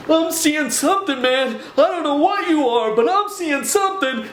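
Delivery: a scared man blurting it out; panicked. Retold or imitated. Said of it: "And Dan goes".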